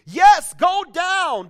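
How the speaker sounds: high, loud and slow insistence; urgent insistence. Retold or imitated. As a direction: push him to go, loud and pressing. Wife urging husband downstairs.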